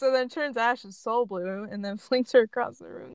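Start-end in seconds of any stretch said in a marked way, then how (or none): none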